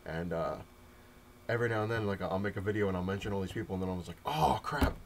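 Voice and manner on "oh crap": mock oh crap. Realizing he left people out.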